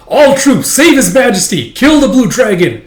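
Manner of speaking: high, very loud rallying cry; shouted battle command. Acted. Commander orders troops to save king, shouted. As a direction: yell orders to the whole army.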